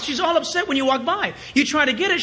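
normal talking voice

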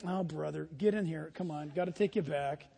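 low, quiet put-on grumbling; weary grumble. Helper fetching the beggar at day's end, weary grumble.

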